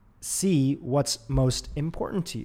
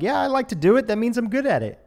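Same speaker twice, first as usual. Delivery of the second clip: put-on high, loud excited kid voice; kid's eager yes; 10 semitones higher, 6 dB louder than usual. Podcaster voicing a young kid's eager confidence. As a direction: chirp it like a proud little kid. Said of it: "you're just like".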